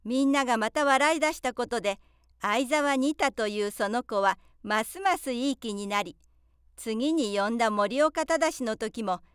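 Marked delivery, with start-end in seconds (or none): none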